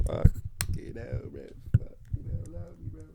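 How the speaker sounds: words squeezed out through laughter; gasping through a giggle fit; swearing while still laughing. Real, not acted. Laughing fit after headphones mishap.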